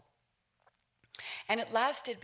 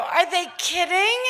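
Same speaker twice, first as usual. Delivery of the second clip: higher, incredulous scoff; mock disbelief; 7 semitones higher, 9 dB louder than usual. Filmmaker scoffing at an old film, incredulous. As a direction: scoff at the film like it's a joke. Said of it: "I could look at it and go".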